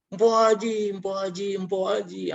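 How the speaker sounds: retold sobbing wail. Relative weeping on arrival at the hospital, imitated sobbing. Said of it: "terseduh-seduh begitu ya".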